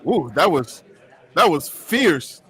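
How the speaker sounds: slow, low mock-impressed ooh; lightly voiced reporters, impressed; a hint of awed reporters. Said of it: "They're like".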